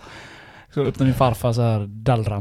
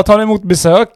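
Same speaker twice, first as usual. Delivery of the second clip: higher, louder mock formal question; polite visitor; 9 semitones higher, 8 dB louder than usual. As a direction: ask like a courteous caller. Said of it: "Jag bara".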